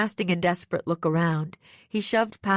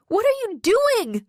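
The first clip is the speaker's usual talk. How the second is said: high, loud alarmed cry; 5 dB louder than usual. A narrator's reading. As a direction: shout it out in alarm. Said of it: "I cried".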